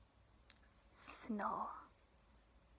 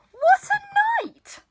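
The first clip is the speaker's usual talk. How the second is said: muttering mole; 13 dB louder than usual. Acted. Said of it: "Mole mutters".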